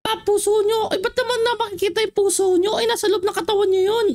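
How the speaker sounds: villager voice pitched high. Acted.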